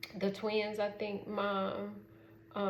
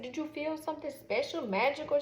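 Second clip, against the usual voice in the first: high, airy wonder-filled question; gushing; 7 semitones higher than usual. Retold or imitated. Mom gushes to daughter about the magical moment, high and airy.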